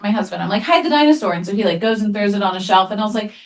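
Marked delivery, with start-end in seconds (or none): 0.6-1.3 urgent order. Retold or imitated. Mom urgently orders husband.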